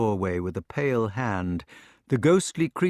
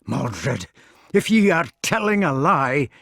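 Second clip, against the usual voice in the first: threat; 4 semitones higher, 6 dB louder than usual. Acted. Gawain threatens.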